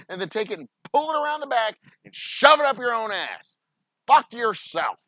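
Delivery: loud, furious cursing; real anger. Real, not acted. Venue owner telling ASCAP off to viewers, furious shouting. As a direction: let the genuine rage explode at them. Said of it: "this is legitimate fucking anger".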